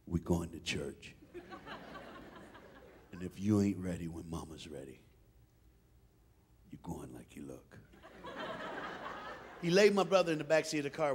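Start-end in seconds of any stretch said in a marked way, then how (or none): 0.1-7.8 menacingly quiet dad. Retold or imitated. Dad warning the unready son, low and deadly quiet. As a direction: drop to a near whisper as the law is laid down.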